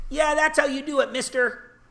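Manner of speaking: put-on high child voice; lightly voiced eager child; little kid voice. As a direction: chirp it like a small kid. Littlest kid answers him.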